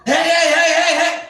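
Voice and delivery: high, rapid repeated heys; mock alarmed shout; imitated calling out. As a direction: mimic a guard shouting as he runs over. Security calls out while running over.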